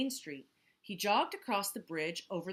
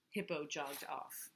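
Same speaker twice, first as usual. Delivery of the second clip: plain reading voice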